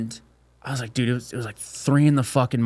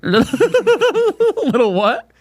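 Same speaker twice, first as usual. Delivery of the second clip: slow, high laughing question; 13 semitones higher, much slower than usual. Real, not acted. Friend cracking up at the cracked egg phrase. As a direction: ask through laughter.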